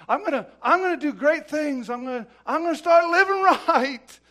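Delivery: loud, high, hammed-up vow; mock bold resolve. Imitating someone vowing to fix himself, loudly overblown. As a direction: belt out a big empty promise. Mimics boastful self-fixing promises.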